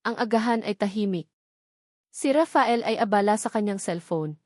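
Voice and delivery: usual speaking voice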